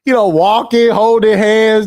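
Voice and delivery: normal talking voice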